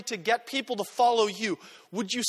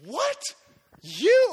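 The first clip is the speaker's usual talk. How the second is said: sudden high, loud cry of surprise; mock disbelief; 8 semitones higher, 6 dB louder than usual. Preacher imagining seeing the healing, high astonished cry. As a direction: jump up in shocked disbelief. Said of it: "I'd be like".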